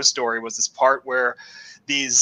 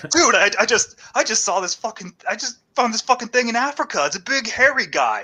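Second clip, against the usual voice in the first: mildly hammed-up excitement, pitched higher; excited discoverer; 7 semitones higher than usual. Man telling people what he found in Africa, excited. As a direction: blurt it out like an amazed explorer.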